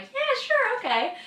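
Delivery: high, loud mock-keen yes; imitated eager agreement. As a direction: mimic a friend agreeing too eagerly. Imitates Ashley eagerly agreeing likewise.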